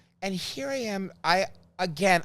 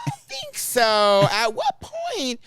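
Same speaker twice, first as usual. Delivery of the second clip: slow, loud disbelief, pitched up; mock indignant; 7 semitones higher, 6 dB louder, much slower than usual. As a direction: drag it out in outraged disbelief. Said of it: "I'm like".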